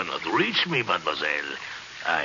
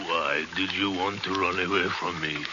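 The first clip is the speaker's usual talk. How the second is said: usual speaking voice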